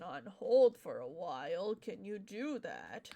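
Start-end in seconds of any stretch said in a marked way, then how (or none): none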